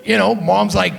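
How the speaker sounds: ordinary talk, nothing marked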